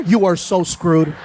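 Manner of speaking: usual speaking voice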